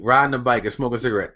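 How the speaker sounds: ordinary delivery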